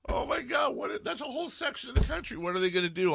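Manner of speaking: high-pitched comic panic; squealing, exaggerated disbelief; mock alarm. Real, not acted. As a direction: exclaim it way up high, aghast. Mock alarm at the voters' dilemma.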